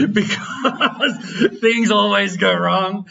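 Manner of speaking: laughing through the words. Real, not acted. Teacher admitting a dangerous function always backfires, laughing. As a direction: break into a drawn-out laugh while talking. Joking about a risky function.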